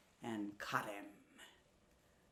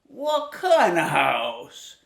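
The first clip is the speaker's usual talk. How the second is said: sly wolf; 13 dB louder, slower than usual. Acted. Wolf questions pig.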